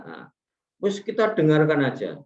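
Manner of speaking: ordinary talk, nothing marked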